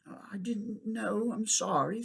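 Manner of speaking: put-on humble, hushed girl's apology; humbly. A narrator's reading. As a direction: say sorry meekly. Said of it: "said Polly humbly".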